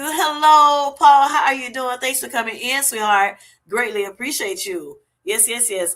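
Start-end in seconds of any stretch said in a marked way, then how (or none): 0.0-2.8 higher and much louder hello; excited greeting. Real, not acted. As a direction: call out hello, delighted to see him. Greeting a viewer arriving in chat.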